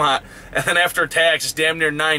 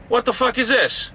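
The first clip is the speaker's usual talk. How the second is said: retold indignant, higher-pitched outburst; exasperated disbelief; 7 semitones higher than usual. Driver reliving being stuck at a gate, exasperated disbelief. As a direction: voice jumps up, baffled and annoyed.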